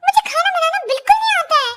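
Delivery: high-pitched, alarmed protest; panicked dismay. Acted. Alarmed by the cooking challenge.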